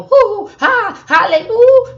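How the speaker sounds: high, loud hooping hallelujah; mocking old-school church hooping, high and loud; mock whooping preacher. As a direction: hoot it like a shouting pastor. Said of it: "it wasn't no".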